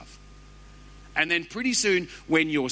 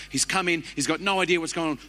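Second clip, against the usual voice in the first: ordinary talk, nothing marked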